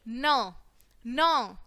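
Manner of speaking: न as an isolated "nuh", not "en"; phonics sound. Shown as a demonstration. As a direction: sound out each न separately. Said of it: "एन की sound कैसे निकलेगी". (न को अलग ध्वनि की तरह बोलें)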